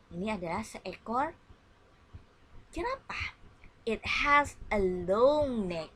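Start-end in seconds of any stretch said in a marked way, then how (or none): 3.9-5.9 model reading. Shown as a demonstration. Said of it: "And you repeat my reading".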